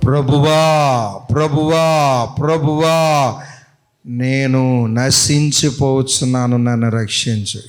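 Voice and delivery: low, slow drawn-out cry; drowning plea. Acted. Expert swimmer crying while sinking, slow and low. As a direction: stretch each ప్రభువా like a sinking swimmer. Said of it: "గజేతగాడు మునుగుపోతూ అంటున్నాడు".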